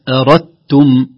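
"أردتم" with d merged into t; assimilated d. Shown as a demonstration. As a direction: say "أردتم" as arattum. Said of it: "لا نقول". ("أردتم" بإدغام الدال)

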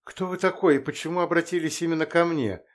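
high, a touch of shaky fear; trembling whisper. A narrator's reading. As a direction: ask it nervously, barely holding together. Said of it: "дрожащим голосом прошептала я".

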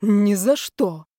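loud, drawn-out breathless refusal; exhaled vow. A narrator's reading. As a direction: breathe it out with mock passion. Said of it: "выдохнул Колчиновский".

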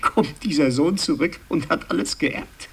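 telling it through a rising laugh; amused laughing. Acted. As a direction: let laughter break into the words. Laughs telling of son's inheritance. (lachend erzählt)

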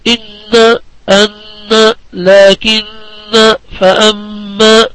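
long nasal نّ in إن، أن، لكن, long مّ in فأما; ghunnah examples. Shown as a demonstration. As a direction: hold each doubled ن in إن، أن، لكن and the م in فأما as a nasal hum. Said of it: "أمثلة في الحروف".

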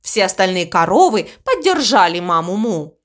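plain reading voice

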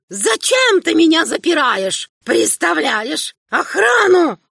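put-on an old king protesting his cage; aggrieved old emperor. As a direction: demand it like a suspicious prisoner. Old emperor accuses son.

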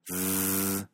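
a long voiced zzz; buzzing z. Shown as a demonstration. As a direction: hold a buzzing zzz in the throat. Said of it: "Sentirás una vibración". (zzz sonora y prolongada)

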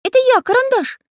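very high, loud cartoon voice; squeaky pencil character. A narrator's reading. Pencil identifying himself to friends, squeaky. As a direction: pipe up eagerly.

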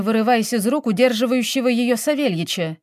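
ordinary delivery, nothing marked

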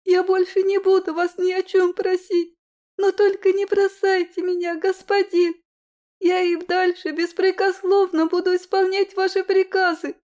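put-on high, loud sobbing child's plea; crying girl. A narrator's reading. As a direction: beg through tears like a little girl. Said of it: "откровенно плачущим голосом".

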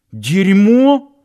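scared squeak on дерьмо; startled, stammering question; frightened stutter. A narrator's reading. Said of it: "продолжал заикаться напуганный". (испуганно, заикаясь)

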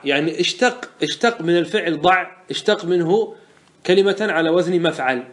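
usual speaking voice

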